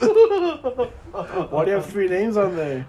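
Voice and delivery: slow laughing question. Pretend. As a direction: drag it out through laughter.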